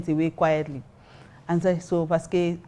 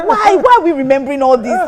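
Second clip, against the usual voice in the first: high, loud exasperated retelling through laughter; mock exasperation; 8 semitones higher, 7 dB louder than usual. Snobbish guest fed up with reminiscing, mock exasperation. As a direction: complain in hammed-up disbelief.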